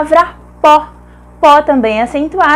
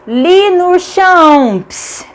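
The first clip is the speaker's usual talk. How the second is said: high, stretched mnemonic word; exaggerated elongated pronunciation; drawn-out reading; 5 semitones higher, much slower than usual. Shown as a demonstration. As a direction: read it slowly, dragging out each syllable. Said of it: "Vamos ler".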